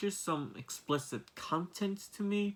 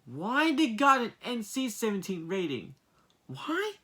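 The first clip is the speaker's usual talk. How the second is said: baffled questioning; 6 semitones higher than usual. Real, not acted. Reviewer questioning the NC-17 rating, baffled. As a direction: pitch leaping up in disbelief.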